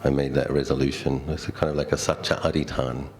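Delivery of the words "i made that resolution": ordinary delivery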